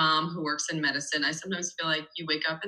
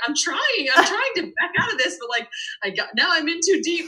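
higher, louder, laughing self-defence; flustered protest; 12 semitones higher, 5 dB louder than usual. Retold or imitated. Mom protests to Dan that she's trying, flustered.

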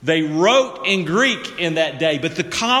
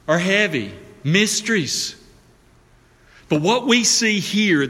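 ordinary delivery

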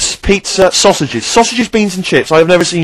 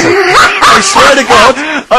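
laughing exclamation; 10 semitones higher, 5 dB louder, much slower than usual. Real, not acted. Laughing outburst.

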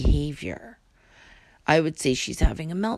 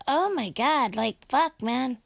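ordinary talk, nothing marked

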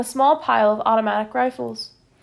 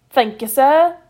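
run together as one word; 3 semitones higher, 7 dB louder, slower than usual. A narrator's reading. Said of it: "making it all one word".

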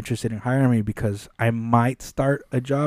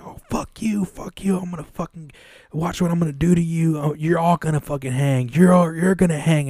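higher-pitched imitation of a threatening rant, not shouted; retold threatening rant; 5 semitones higher than usual. Imitating a resident going off on the new worker, retold threatening rant. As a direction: go off on someone in a mock, held-back voice. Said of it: "He's threatening me".

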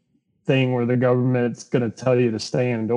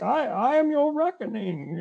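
much higher put-on imitation of the Bane voice; 11 semitones higher, 3 dB quieter than usual. Retelling a Harley Quinn scene to friends, mock Bane voice. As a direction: imitate a cartoon Bane's grand threat. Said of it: "the Dark Knight Rises Bane voice".

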